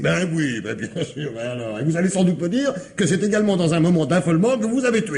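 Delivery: high, fast mocking taunt; sarcastic jab. Commissaire mocking the murderer's excuse of panic, sarcastic. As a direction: throw his excuse back at him with scorn.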